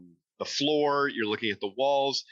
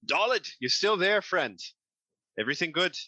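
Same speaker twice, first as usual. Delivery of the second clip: higher, slightly raised call to a friend; 8 semitones higher, 3 dB louder than usual. Acted. Bruegel calls to Dalit left below, friendly calling out. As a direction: call down to check on a companion. Game master's character calls down to companion.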